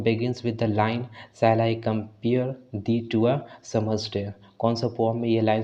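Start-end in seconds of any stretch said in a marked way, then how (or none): 1.4-4.3 very slow, every word spelled out for students; slow classroom dictation of the quiz question; slow careful reading. Shown as a demonstration. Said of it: "the correct pronunciation is that poem".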